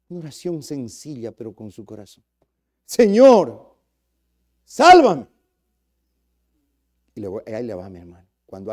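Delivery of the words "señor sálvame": high, loud cry for rescue; loud desperate plea. Acted. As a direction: shout it like sinking in the water. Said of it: "Suplicó, rogó".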